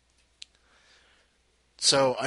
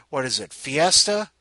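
normal talking voice

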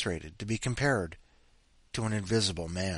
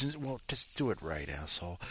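muttered insult; 4 semitones lower, 9 dB quieter than usual. Real, not acted. Muttered retort to the critics.

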